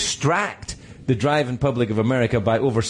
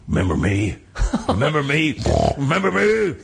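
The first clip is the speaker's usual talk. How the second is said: vengeful elephant; 9 semitones higher than usual. Acted. Elephant confronts its old rider after years, taunting, climbing ever higher. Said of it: "will now go to you".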